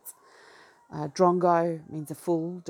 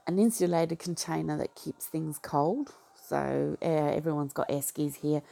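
ordinary talk

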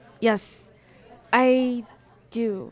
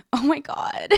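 excited giggle; 6 semitones higher, 8 dB louder than usual. Acted.

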